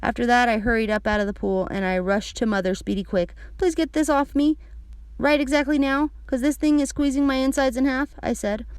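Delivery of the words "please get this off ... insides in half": put-on high child's plea; higher, pressing kid voice; urgent little girl. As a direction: plead for help like a squeezed kid. Child begs for help.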